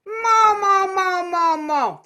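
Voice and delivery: high, loud nasal mo calls. Shown as a demonstration. Teacher modelling nasal mo, loud. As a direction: sing mo into the nose. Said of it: "That's hard to be nasal on".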